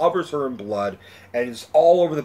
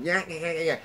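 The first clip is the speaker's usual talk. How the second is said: quick, soft, mocking yap-yap; imitated yapping; 4 semitones higher, 6 dB quieter than usual. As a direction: mimic someone's endless chatter. Mimicking her nonstop chatter.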